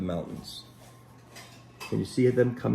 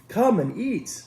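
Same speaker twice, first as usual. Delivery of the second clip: shepherd's call; 10 semitones higher, slower than usual. Acted. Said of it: "which means".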